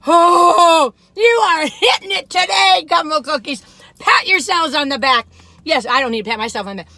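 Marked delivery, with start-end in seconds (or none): 0.1-5.3 loud, high, drawn-out cheer; exuberant praise. Real, not acted. Reviewer congratulating the cookie makers, exuberantly loud. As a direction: cheer them on at full voice.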